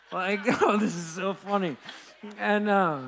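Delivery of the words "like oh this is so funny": laughing through the words; snickering. Retold or imitated. To the church, retelling his nervous laugh on the plane, snickering. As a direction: chuckle it out, amused at the panic. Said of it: "start snickering".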